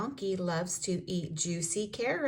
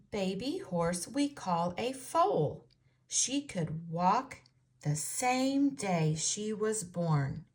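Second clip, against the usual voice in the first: usual speaking voice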